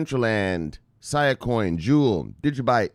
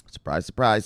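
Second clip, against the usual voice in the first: ordinary talk, nothing marked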